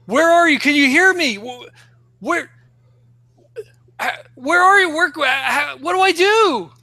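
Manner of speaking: high, loud panicked calling out; frantic calling. Acted. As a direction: search for her in a panic. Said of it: "so he's like".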